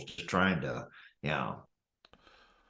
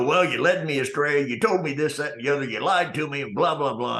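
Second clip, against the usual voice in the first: raised, quick indignant rant, a bit higher; mock the furious customer tearing into the manager; livid customer ranting; 6 semitones higher, 3 dB louder than usual. Customer berating the store manager, heated retold rant. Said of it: "he's just livid going".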